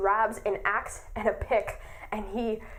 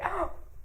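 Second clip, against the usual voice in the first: gasp; 6 semitones higher, 6 dB quieter than usual. Real, not acted. Shocked by the plot twist.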